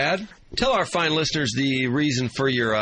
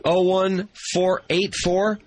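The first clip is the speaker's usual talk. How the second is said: slow dictation; 4 semitones higher, much slower than usual. Shown as a demonstration. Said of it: "reading the number back slowly".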